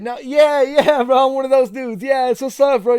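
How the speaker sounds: high, loud, fan-like yes; hyped-up reply. Retold or imitated. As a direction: play along, matching his hype. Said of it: "And I said".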